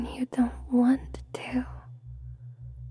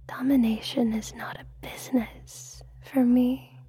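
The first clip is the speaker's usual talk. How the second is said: intimate ASMR whisper; breathy hypnotic whisper close to the mic. Acted.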